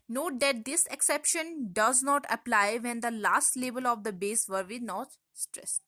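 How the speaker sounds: very slow, word by word rule reading; slow dictation. Acted. Teacher dictating a grammar rule to the class, very slow. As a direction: read the rule out slowly for notes.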